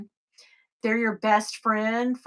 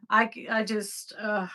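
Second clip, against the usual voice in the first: usual speaking voice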